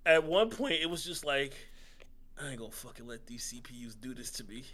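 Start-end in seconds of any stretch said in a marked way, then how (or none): 2.4-4.6 low, very soft, quick mutter; muttered resolve. Retold or imitated. Loser vows to himself against the computers, muttered resolve. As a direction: grumble it under your breath, fast. Retells his private resolve while losing.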